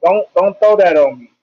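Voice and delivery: ordinary delivery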